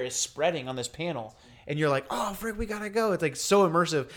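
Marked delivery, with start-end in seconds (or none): 2.1-3.1 high, hushed-down panic; lightly acted urge to flee; mock alarm. Acted reaction to the fire.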